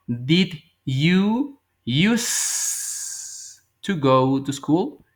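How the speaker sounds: each word drawn out very slowly; slow model sentence. Shown as a demonstration. Teacher models the English question for students, very slow. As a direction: spell the question out slowly for learners. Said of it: "Y digo".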